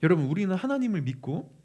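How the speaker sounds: ordinary delivery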